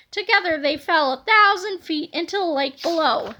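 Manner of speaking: plain reading voice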